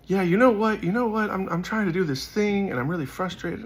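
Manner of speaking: high, eager voice of someone opening up; stranger's confession. Retold or imitated. Stranger admits frustration to the praying speaker, high eager voice.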